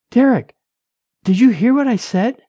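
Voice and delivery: insistent, high-pitched wife; Rachel, anxious wife. A narrator's reading. Wife pressing her silent husband, insistent.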